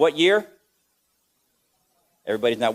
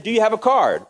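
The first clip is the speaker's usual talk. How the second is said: woman's voice; 7 semitones higher, 4 dB louder than usual. Retold or imitated. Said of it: "She said".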